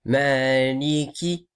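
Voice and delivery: slow "Maliki", long a, short last i; careful model of "Maliki"; short final vowel. Shown as a demonstration. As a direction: draw out "Maa" in "Maliki", end briefly. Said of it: "Donc c'est".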